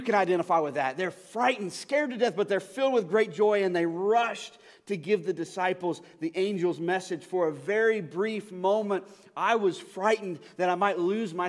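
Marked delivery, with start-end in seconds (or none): none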